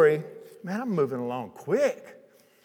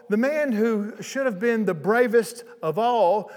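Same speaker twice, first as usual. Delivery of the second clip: ordinary talk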